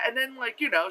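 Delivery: ordinary talk, nothing marked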